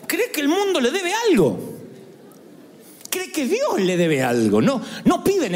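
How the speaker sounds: ordinary delivery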